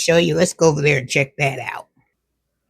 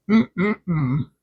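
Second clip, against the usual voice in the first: soft mm-mm-mm of delight; savouring hum; 3 dB quieter than usual. Real, not acted. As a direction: hum happily over the taste. Savouring a bite of cookie.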